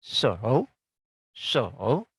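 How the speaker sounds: So with the whole dip-and-rise; full third tone. Shown as a demonstration. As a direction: let So sink, then climb. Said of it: "nada tiga ditambah nada empat".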